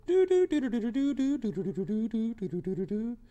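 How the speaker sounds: high far-off doo doo tracing the route; mock route hum. Shown as a demonstration. Teacher humming the ship's path on the map. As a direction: hum the long way round on the map. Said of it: "the old way would go".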